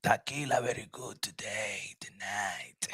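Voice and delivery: whispered joke about tequila; whispered aside. Acted. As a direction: lean in and whisper it like a secret.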